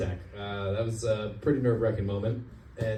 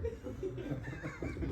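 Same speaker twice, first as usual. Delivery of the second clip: breathy laugh; 14 dB quieter than usual. Real, not acted. Comedian laughs at own joke.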